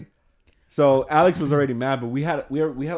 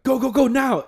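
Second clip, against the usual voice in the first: high, urgent hurry-up; 11 semitones higher, 5 dB louder than usual. Retold or imitated. Alex urging Joe to throw at the passing truck, urgent. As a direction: push him to throw right now.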